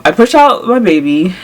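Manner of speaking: usual speaking voice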